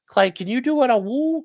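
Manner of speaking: high coaxing plea to a pet; high playful coaxing of the dog. Real, not acted. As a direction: egg the dog on to howl.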